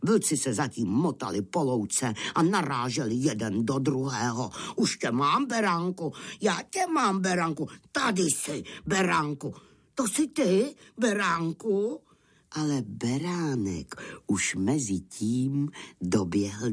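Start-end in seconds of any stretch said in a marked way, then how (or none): 4.8-12.0 raised, excited wolf calls; searching wolves. Acted. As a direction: call out higher, groping for the lamb. Blindfolded wolves grope for the lamb. (vzrušené vlčí pokřikování vyšším hlasem)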